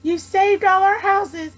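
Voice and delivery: a speck-sized person thanking with emotion; tiny grateful voice. A narrator's reading.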